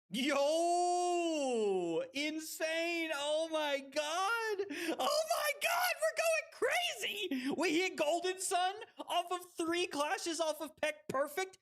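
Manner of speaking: high, elated shouting; hyped celebration. Real, not acted. Streamer celebrating a golden sun hit, hyped shouting. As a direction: cheer in disbelief at a huge play.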